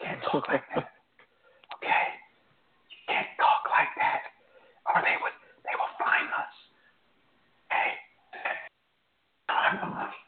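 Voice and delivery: hushed conspiratorial whisper; mock secret whisper. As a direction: whisper like someone might be listening. Gotta watch what I say.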